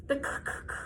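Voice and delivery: breathy, clipped "k, k, k"; letter sound. Shown as a demonstration. Teacher modelling the K sound for children. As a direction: tap out the bare K sound. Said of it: "k sound".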